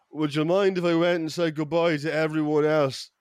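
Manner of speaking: put-on lightly slurred drunk Irish friend; mock drunken slur. Drunk Irish buddy asking to say goodbye, lightly slurred. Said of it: "he slurs to me".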